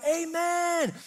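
high, loud desperate yell of amen; mock scream. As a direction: scream it out to stop the runaway horse. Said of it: "he screams out".

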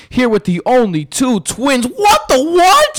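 high, excited show intro; hyped host voice. Acted. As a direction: pump up the intro.

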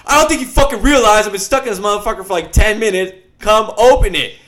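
snapping at her to hurry up and come; frantic demand. Retold or imitated. Trapped in the elevator, phoning his girlfriend: fast, exasperated yell.

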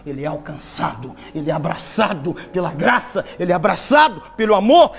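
loud, slow sermon build; preaching at full force. Acted. Preacher thundering to the congregation, loud and slow.

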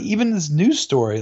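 ordinary talk, nothing marked

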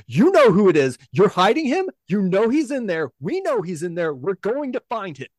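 loud, high, insistent accusation; accusing officer. Acted. Militia officer accusing the barkeep of hiding him, loud and high. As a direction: corner her, you're hiding him.

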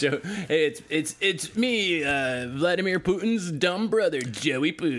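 put-on high, drawn-out comic Russian accent. Introducing himself as Putin's dumb brother, Russian accent. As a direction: play a goofy Russian brother, voice lifted. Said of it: "such a killer Russian accent".

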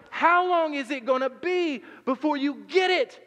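high, loud exasperated plea; frustrated parent. Retold or imitated. Parent exasperated at kids who still don't get it, loud. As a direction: throw up your hands at the kids. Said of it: "And we're frustrated".

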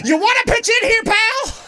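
high, loud yell like her dad; dad's shout. Retold or imitated. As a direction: boom it out like an exasperated father. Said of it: "that's what my dad used to say".

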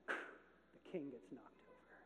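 soft, slow whisper; hushed moment of the king falling; whispered climax. A narrator's reading. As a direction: let it fall quietly. Dramatic climax after booms.

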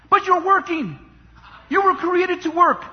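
ordinary talk, nothing marked

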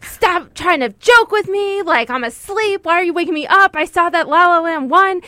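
high, loud irritated whine; retold anger. Half-asleep woman to boyfriend who woke her, acted-out angry protest. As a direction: act out being furious at whoever woke you. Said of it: "I got so mad at him".

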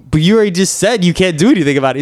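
fast, high-pitched objection; exasperated challenge. Retold or imitated. Patient catching the clinic kid contradicting himself, exasperated. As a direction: rattle off the contradiction in disbelief.

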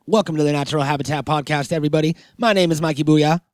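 normal talking voice

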